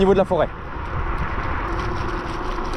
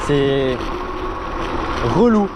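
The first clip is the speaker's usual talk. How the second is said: exasperated; much slower than usual. Real, not acted. Annoyed about braking in town.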